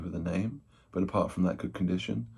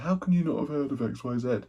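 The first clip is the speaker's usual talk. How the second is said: higher-pitched mock commenter; incredulous commenter; 7 semitones higher than usual. YouTuber quoting commenters who query his knowledge, mock incredulous. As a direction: imitate an indignant online commenter, pitched up. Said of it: "people have come in the comments and says".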